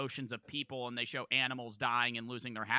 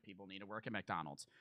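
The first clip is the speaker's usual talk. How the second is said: ordinary talk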